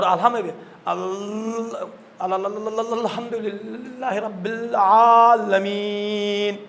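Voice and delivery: stuttering slowly through a recitation; imitated stammer. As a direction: catch repeatedly on the "al", then stretch the words out. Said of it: "آپ لکنت زبانی ہیں".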